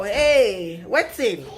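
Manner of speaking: usual speaking voice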